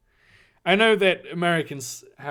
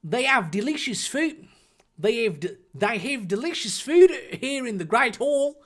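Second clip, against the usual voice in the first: higher put-on Australian boy; halting stage Australian; Australian accent; 8 semitones higher, 3 dB louder than usual. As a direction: offer it in a friendly mock Australian accent. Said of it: "Make him Australian".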